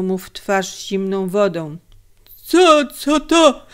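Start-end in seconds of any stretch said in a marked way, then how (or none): 2.4-3.7 dazed, startled, high-pitched questioning; high, confused stammer; bewildered mumbling. A narrator's reading. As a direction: ask it haltingly, just come to and astonished. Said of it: "mamle zdziwiony".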